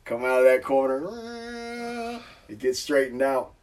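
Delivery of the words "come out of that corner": high, slow stretched phrase; drawn out. Retold or imitated. Drawn-out high retelling of the corner.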